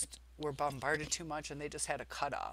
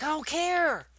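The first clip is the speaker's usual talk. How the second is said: much higher and louder, snapping it out; retold snappy outburst; 11 semitones higher, 11 dB louder than usual. Daughter asked again about dinner, loud snapping reply. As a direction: fling it back as a loud retort. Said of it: "She just gave me attitude today".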